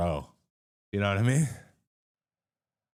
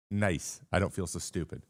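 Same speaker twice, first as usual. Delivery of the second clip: usual speaking voice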